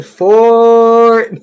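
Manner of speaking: long, high golfer's warning yell; retold shout across the course. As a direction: holler it out loud and drawn-out. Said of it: "we just start yelling".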